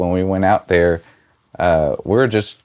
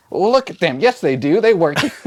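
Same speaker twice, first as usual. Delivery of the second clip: higher-pitched retold protest; insistent husband; 9 semitones higher than usual. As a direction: imitate a husband insisting he is right. Husband insisting in retold family exchange.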